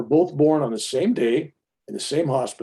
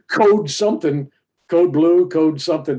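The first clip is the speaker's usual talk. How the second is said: mock alarm call; 3 semitones higher, 6 dB louder than usual. Imitating the hospital alarm call.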